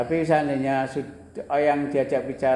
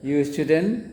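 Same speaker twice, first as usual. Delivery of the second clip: You student? with "student" rising; rising question tune; 2 semitones higher, 4 dB louder, slower than usual. Shown as a demonstration. Teacher shows a question by tune, rising. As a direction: lift "student" to ask. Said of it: "tapi nadanya nada bertanya".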